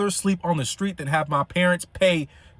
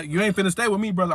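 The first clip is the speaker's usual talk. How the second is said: ordinary delivery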